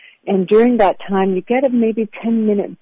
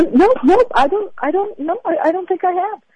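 flustered; 11 semitones higher than usual. Retold or imitated. Flustered reply in retold audition.

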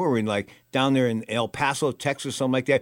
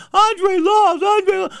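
high, frantic, near tears woman's voice; mimicking Marcia; 6 dB louder, much slower than usual. Gym owner telling the host the bad news, high and upset. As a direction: pitch way up, almost crying. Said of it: "She goes".

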